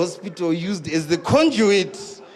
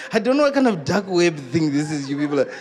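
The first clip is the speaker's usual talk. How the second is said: ordinary talk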